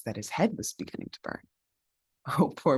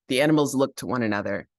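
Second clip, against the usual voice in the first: usual speaking voice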